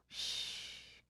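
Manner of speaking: hushed breathy snore sound; pretend snore. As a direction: fake a sleepy snore. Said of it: "pretend to snore".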